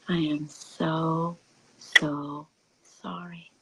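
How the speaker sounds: soft slow whispered sorry; tender comfort. Pretend. Speaking to one's own fear and anger, slow soft whisper. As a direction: hold your own fear gently. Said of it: "and say".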